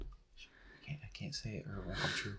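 hushed secretive whisper. Pretend. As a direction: whisper like guarding a wish. Keeping a wish secret.

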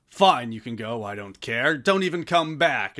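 loud mock-huffy dismissal; put-on stubborn outburst; Jet in a huff. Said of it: "where Jet's like".

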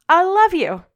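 high, loud burst of affection; playful loving yell. Acted. Mother surprises worried kids with love, playful high yell. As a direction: yell it gleefully, high and sudden. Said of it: "you yell".

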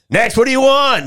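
high, loud hammed-up cashier yell; yelling woman. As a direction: shout it over the crowd like a rushed server. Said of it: "this woman just yelling out".

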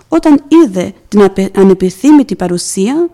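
ordinary talk

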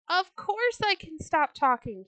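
high, loud outburst; indignant cry. A narrator's reading. Turtle unable to bear the children's jeers, loud high cry. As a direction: burst out loudly, pitch jumping up. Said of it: "he cried".

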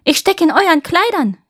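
put-on high, loud girl's call. A narrator's reading. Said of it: "rief das Mädchen".

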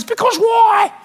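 high whiny why; whiny child. Retold or imitated. Preacher mimicking a childish why to God. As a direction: ask it like a sulky toddler.

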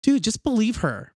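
exasperated plea. Retold or imitated. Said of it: "I'm kind of like".

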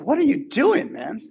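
a king throwing up his hands; exasperated king. Retold or imitated. King baffled by Balaam's blessing, high and incredulous. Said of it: "the king's like".

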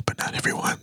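breathy unvoiced whisper. Shown as a demonstration. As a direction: drop to a secret whisper. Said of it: "I'm gonna try to whisper".

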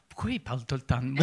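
quick low mock daughter line; imitating the daughter. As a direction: fire it off as a put-out kid. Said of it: "elle va faire". (imitation rapide de la fille)

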